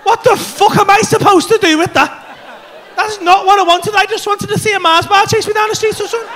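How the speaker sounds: high, indignant complaint; exasperated disbelief. Retold or imitated. Comedian reacting to his friend having moved a star, exasperated. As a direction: protest it with comic outrage.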